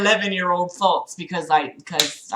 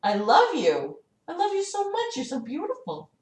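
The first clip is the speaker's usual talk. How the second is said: tender cooing; 5 semitones higher, 10 dB quieter than usual. Real, not acted. Owner telling cat she is loved, real tender cooing. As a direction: coo adoringly to a pet.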